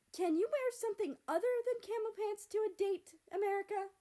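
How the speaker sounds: high, loud, fed-up snap; exasperated snap. A narrator's reading. Mother snapping at her son over his pants, exasperated. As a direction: snap at your son, at the end of your rope. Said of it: "France snapped at her son in exasperation".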